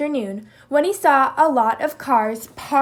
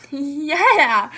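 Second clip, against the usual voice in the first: laughing; 2 semitones higher, 5 dB louder than usual. Acted. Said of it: "laughed Kangaroo".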